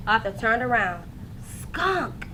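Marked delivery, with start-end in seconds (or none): 1.4-2.3 soft, hushed warning; whisper. A narrator's reading. Boy spotting a skunk, hushed warning. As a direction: breathe the word so the skunk won't hear. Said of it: "he whispered".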